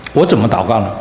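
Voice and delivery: usual speaking voice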